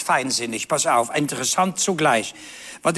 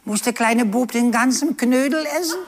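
put-on child's question; 7 semitones higher than usual. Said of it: "Da fragt er selbstvergessen".

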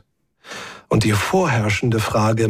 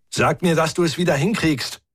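higher, pressing call; mildly raised pleading call; 6 semitones higher than usual. A narrator's reading. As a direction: urge someone down a shaft. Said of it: "rief ich in den Schacht hinab".

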